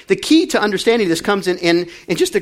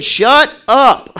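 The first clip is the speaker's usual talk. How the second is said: mock yell; 7 semitones higher, 9 dB louder than usual. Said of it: "they're literally saying".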